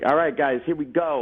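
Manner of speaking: lightly voiced blunt New York AD; a hint of a gruff New York accent calling the crew. First AD rallying the crew, light New York accent. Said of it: "'Cause he talks like".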